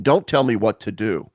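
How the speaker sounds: ordinary talk, nothing marked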